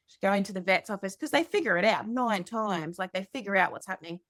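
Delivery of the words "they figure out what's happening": ordinary delivery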